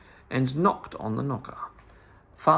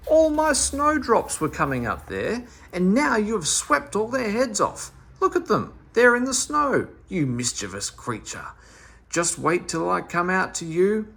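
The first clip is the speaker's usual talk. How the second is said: high, louder furious scolding; angry scolding; 7 semitones higher, 4 dB louder than usual. Acted. Dame Fanny scolds Meddle from her window, high and louder. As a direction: tear into him over the ruined snowdrops. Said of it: "she shouted".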